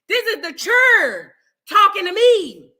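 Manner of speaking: high, loud, drawn-out disbelief; retold outrage. Teacher repeats to viewers what shocked her, outraged disbelief.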